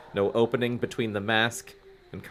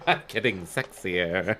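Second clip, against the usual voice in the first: sexy voice; 5 semitones lower, slower than usual. Real, not acted. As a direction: laugh it out seductively. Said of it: "My voice".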